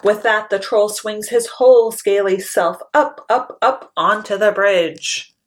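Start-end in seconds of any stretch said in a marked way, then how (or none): none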